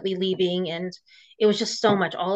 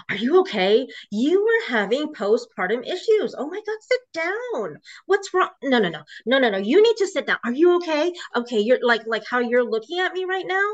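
high, fluttery put-on concern, rushing over her; fake worried husband; 5 semitones higher than usual. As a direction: mimic the husband faking alarm to make her doubt herself. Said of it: "he would just keep talking like that".

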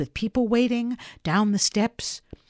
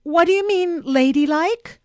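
high, loud query on "ladylike"; challenging question; 10 semitones higher, 8 dB louder than usual. Acted. Girl questioning Popper's answer, challenging "ladylike".

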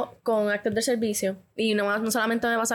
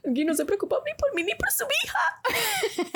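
mock tearful thought. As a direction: play a hurt pregnant wife half-laughing. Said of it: "Yo lo que pensé fue". (queja aguda y llorosa entre risas)

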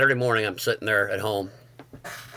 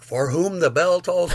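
slow disbelief; 8 semitones higher, 4 dB louder, slower than usual. Retold or imitated. Teller realizing the song title, "For Whom the Bell Tolls" slow and amazed. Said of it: "saying it like it was one word".

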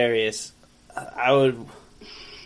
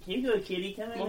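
cooing to a cat; 8 semitones higher, 9 dB quieter, faster than usual. Real, not acted. Talking sweetly to the cat.

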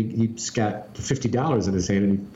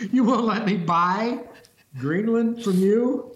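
mock whiny Trump; 10 semitones higher than usual. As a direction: whine it like a sulking leader. Mocking Trump's reaction.

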